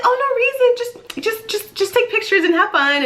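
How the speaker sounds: very high, giddy mock-innocent mom; excited mom imitation. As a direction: play an overexcited mom brushing off a question. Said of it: "this is how I imagine, like, her on the end of the phone".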